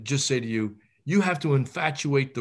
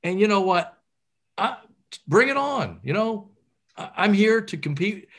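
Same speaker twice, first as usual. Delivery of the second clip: confident competitor; 6 semitones higher than usual. Retold or imitated. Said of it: "at least that you say".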